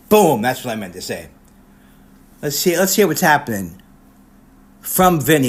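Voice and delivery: ordinary delivery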